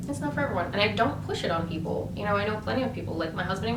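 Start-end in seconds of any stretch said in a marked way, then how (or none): none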